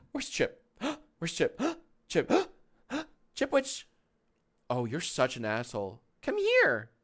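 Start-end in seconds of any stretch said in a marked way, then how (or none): 0.0-3.9 high gasping pretend search for the dog; hammed-up gasps of mock alarm; mock surprise. 6.2-6.9 high, loud call to the dog. Real, not acted. Calling the dog again.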